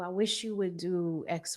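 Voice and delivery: ordinary talk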